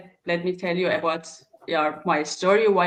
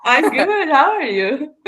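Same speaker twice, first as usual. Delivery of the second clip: laughing through the words, higher and louder; 7 semitones higher, 6 dB louder than usual. Real, not acted. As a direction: answer the greeting with a real laugh. Laughing at host's cheerful greeting.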